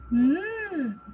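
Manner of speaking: humming mm sound; letter sound mm. Shown as a demonstration. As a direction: hold a clean mm hum.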